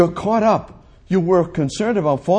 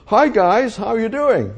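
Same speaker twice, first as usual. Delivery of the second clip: light, higher-pitched breezy hello; casual greeting; 7 semitones higher than usual. Pretend. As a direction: toss out a friendly imagined greeting. Imagines Jesus greeting the disciples.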